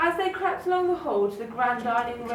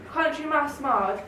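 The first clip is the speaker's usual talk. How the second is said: plain reading voice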